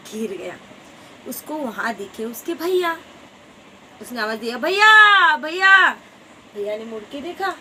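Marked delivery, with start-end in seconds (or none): 4.6-5.9 loud high call across the sky. Acted. Little drop calling his brother, loud call. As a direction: shout for big brother from afar.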